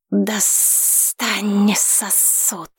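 muffled hissing command, very slow; slow hissing order from a huge beast; dragon voice. A narrator's reading. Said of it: "глухой и шипящий".

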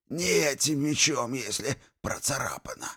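high, fading to a whisper; strained, squeezed out. A narrator's reading. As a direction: squeeze the words out in pain. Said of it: "выдавил Игнациус".

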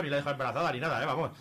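normal talking voice

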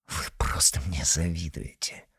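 soft, unvoiced scornful whisper; sneering whisper. Izotov sneering at envious comrades in a whisper. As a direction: breathe it out with contempt.

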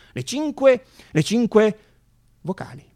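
forte e acuto, da presentatore; annuncio enfatico. Real, not acted. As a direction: proclamalo come un grande annuncio. (annuncio trionfale ad alta voce)

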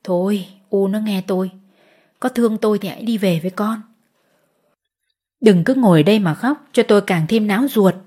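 a husband softly begging his wife to leave; earnest urging. Acted. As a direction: coax her gently to go home. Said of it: "thiết tha giục".

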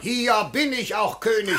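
pompous laughing shout. Acted. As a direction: crow it out half laughing. Official boasting mid-quarrel.

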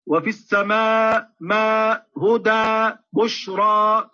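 وفي السماء ما هدى بشرى chanted slowly with open a vowels; tajweed demo; slow recitation demo. Shown as a demonstration. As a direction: chant slowly, jaw wide on each a. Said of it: "الفتح يكون بالتباعد بين الفكين".

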